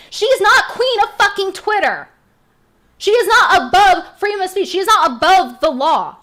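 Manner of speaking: loud, high, hammering each not; furious declaration. Real, not acted. Vlogger denouncing Eliza Blue to viewers, loud and furious. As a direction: slam it down in outrage.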